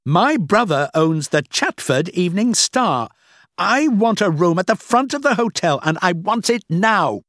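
high, loud, self-important demand; angry shouting. A narrator's reading. Guest demanding a room from the clerk, angry shouting. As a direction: throw your weight around loudly. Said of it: "He was shouting at the man".

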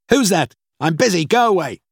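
high, loud call through a door; irritated shout. A narrator's reading. Busy boss to a knocker at his office door, irritated shout. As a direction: shout crossly at whoever is knocking. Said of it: "he shouted".

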